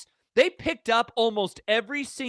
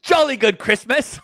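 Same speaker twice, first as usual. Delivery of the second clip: mock British accent; 4 semitones higher, 5 dB louder, slower than usual. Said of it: "Tina says".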